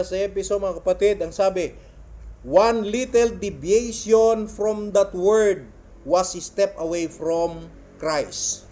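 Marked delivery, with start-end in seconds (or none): 2.4-8.6 very slow, weighted declamation; emphatic quote reading. Real, not acted. Preacher reading a quote on straying from the word, emphatic. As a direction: stretch out each phrase as you read aloud. Reads quotation to congregation.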